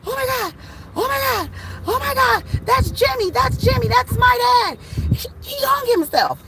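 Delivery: high-pitched, frantic retelling; imitated hysterical screaming. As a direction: act out a shocked daughter crying out. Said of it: "crying, and screaming and yelling".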